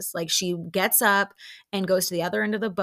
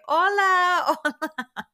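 high, loud sing-out with laughter; put-on greeting breaking into laughs; mock cheery greeting; 11 semitones higher, 5 dB louder, slower than usual. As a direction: imitate calling out to passers-by. Acted group greeting while waving.

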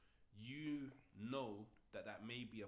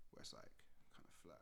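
whispering; 9 semitones lower, 13 dB quieter than usual. Real, not acted. Trails into whisper.

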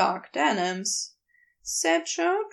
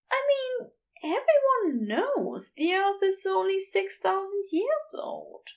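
Aziraphale's voice; 8 semitones higher than usual. Acted.

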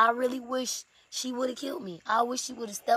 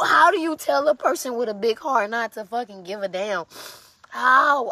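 pained outburst; 7 dB louder than usual. Real, not acted.

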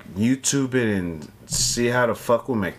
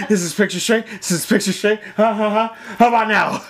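high goofy cartoon dad voice; Peter Griffin; 9 semitones higher, faster than usual. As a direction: ham up a dopey cartoon father. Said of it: "sound like Peter Griffin on meth".

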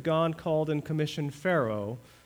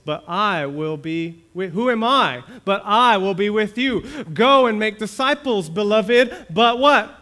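raised, higher, louder scripture proclamation; fervent preaching; 7 semitones higher, 9 dB louder than usual. Acted. Pastor proclaiming scripture to the congregation, raised and fervent. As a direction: proclaim it to the whole church.

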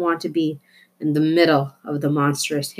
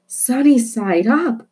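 dreamy delight; 6 semitones higher, 4 dB louder, slower than usual. Acted. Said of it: "thought Ulysses".

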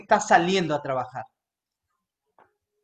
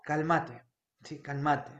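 soft calming; 5 semitones lower, 10 dB quieter than usual. Retold or imitated. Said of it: "self-calming, fading".

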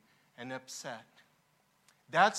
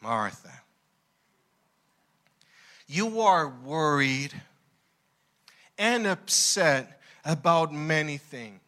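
gentle correction; 4 semitones lower than usual. Acted. Jesus gently correcting Martha.

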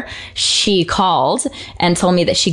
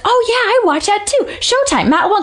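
excited fan; 14 semitones higher than usual. Retold or imitated. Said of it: "and he's like".

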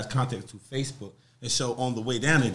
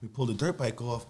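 usual speaking voice

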